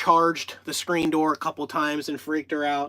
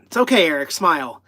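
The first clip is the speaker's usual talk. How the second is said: normal talking voice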